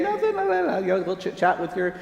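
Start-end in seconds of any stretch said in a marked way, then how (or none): 0.0-0.8 put-on high sing-song child brushing it off; sing-song kid. Kid voiced in story.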